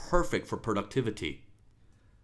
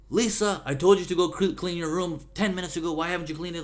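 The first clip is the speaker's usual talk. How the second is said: higher, louder angry scolding; angry parent; 8 semitones higher, 6 dB louder, faster than usual. Retold or imitated. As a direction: scold the kid for the messy room. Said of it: "And then suddenly the anger".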